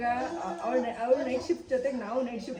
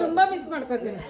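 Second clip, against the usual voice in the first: louder, higher, with a laugh; speaking up; 8 semitones higher, 7 dB louder than usual. Real, not acted. Relative asked to speak louder about missing the bride, speaking up. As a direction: raise your voice as asked, laughing. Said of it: "ಜೋರಾಗ್ ಮಾತಾಡು ಒಂಚೂರು".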